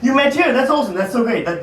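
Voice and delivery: ordinary talk, nothing marked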